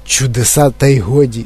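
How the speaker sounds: usual speaking voice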